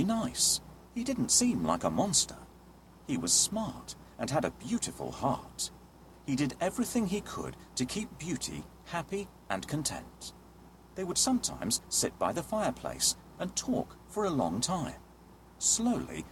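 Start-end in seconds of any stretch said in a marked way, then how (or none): none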